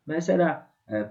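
usual speaking voice

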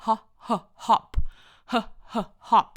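"hop" built up from two short "huh" sounds; separated "huh, huh, hop"; phonics sounding-out. Shown as a demonstration. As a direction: puff the h twice, then say "hop". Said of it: "แต่ออกเสียงว่า".

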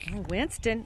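normal talking voice

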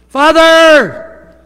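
high, loud anguished cry to the Father. Acted. Jesus crying out to the Father from the cross, loud anguished call.

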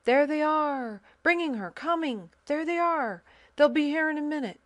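high, loud, fast excited cries; excited calling out. A narrator's reading. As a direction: shout the news to everyone, fast. Soldiers calling out as procession comes.

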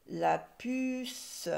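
"puce" held high and long; drawn-out word. Shown as a demonstration. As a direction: stretch the vowel and final s of "puce". Teacher sounding out the word.

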